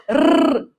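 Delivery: first "R" rolled, second "R" rasped in the throat; "R, R": long trill, then guttural fricative; two R sounds. Shown as a demonstration. Teacher recapping both R sounds, trill then throat. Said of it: "eu tenho dois sons de R".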